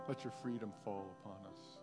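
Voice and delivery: ordinary talk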